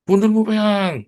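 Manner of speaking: sudden high, loud cry; a jolting yell to shock the congregation; shouted blurt. Acted. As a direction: blurt it out at the top of the voice. Preacher blurts crude phrase.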